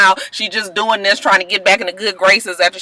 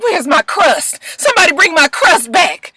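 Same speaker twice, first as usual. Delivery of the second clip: put-on high, whiny old lady demand; high querulous granny voice; crazy granny; 10 semitones higher than usual. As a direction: whine for the crust like a confused granny. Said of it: "she was just like".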